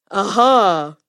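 a lion's delighted little discovery; lightly voiced lion spotting a treat; pleased lion. A narrator's reading. Lion spots a mouse as dessert.

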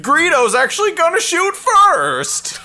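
loud, high, drawn-out cartoon announcer; hammed-up cartoon correction; weird cartoon voice. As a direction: overrule the hero as a blaring cartoon. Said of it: "cartoon voice would be like".

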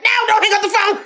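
high-pitched yell breaking into laughter; mock panicked shout. Quoting a Scream Five character on the phone, mock panicked shout. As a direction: yell it out, cracking up. Shouted movie quote while cracking up.

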